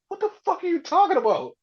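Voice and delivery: fast, high incredulous demand; baffled. Retold or imitated. Punk demanding an explanation, fast high disbelief. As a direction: rattle it off high and stunned. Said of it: "his face from it".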